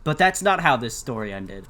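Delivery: ordinary delivery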